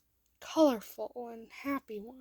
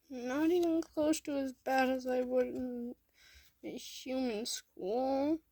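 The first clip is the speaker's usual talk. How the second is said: put-on upset child; 4 semitones higher, 4 dB louder than usual. A narrator's reading. Upset child argues back.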